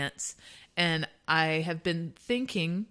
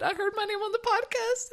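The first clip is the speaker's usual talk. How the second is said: excited quote. Retold or imitated. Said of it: "she said".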